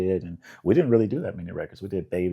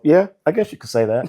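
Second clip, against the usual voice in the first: modest shrug; 8 semitones higher, 5 dB louder than usual. Retold or imitated. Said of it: "I go".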